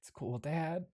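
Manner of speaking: hushed, trailing comfort; subdued, forgiving murmur; soft reassurance. Acted. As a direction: let Dad off gently and quietly. Son reassures apologizing father.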